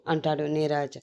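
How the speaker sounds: ordinary delivery, nothing marked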